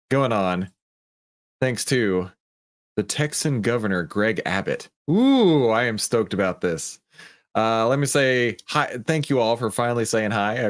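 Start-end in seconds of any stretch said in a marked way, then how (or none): 5.1-5.7 excited ooh. Real, not acted. Excited about the news.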